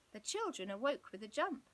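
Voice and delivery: ordinary delivery, nothing marked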